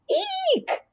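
very high startled shriek; frightened shriek. Acted. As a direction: squeal as if a mouse jumped on you. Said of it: "Marty shouted".

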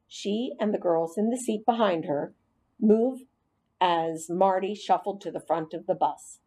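ordinary delivery, nothing marked

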